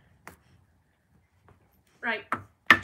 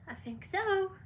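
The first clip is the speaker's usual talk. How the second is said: high pet voice; 10 semitones higher than usual. Real, not acted. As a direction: chirp it up high for the dog. Answering for the dog.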